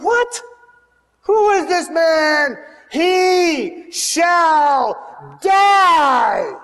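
high, loud shouted verdict; a king condemning the thief, furious shout; furious royal outburst. Acted. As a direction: bellow the death sentence in rage. David furious, condemning the man.

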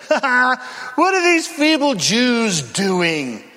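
slow sneering mock laugh. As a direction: taunt them like Sanballat. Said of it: "Can you hear the stinging mocks?".

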